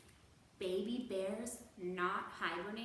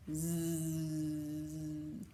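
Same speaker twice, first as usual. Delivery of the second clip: snoring; 5 semitones lower than usual. Acted. Bear still snoring.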